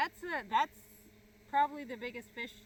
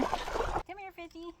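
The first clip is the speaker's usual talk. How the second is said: talking to fish; 8 semitones higher, 6 dB quieter than usual. Real, not acted. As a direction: coax the fish like a pet. Coaxing the fish in.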